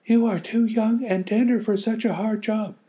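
high, loud pleading voice; pitying neighbor. Acted. Neighbor pitying the girl, high and loud. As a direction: fuss over the child.

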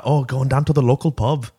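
put-on botched British accent, sliding into Irish or Scottish; attempted British accent. Podcaster trying a British accent on co-host's request, badly faked British accent. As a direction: put on a bad British pub-goer's accent. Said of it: "I can't put on a British accent".

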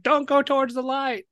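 a hint of the Poltergeist psychic. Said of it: "That was a good Poltergeist voice".

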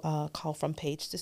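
ordinary talk

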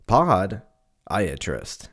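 very slow "podiatrist", broken into POD... iatrist; "podiatrist" sounded out in two slow chunks, "pod" first; podiatrist split after POD. Shown as a demonstration. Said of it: "It's like pod, like podcast".

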